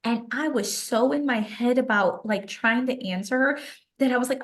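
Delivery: ordinary talk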